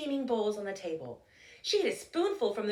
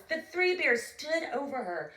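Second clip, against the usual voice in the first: ordinary delivery, nothing marked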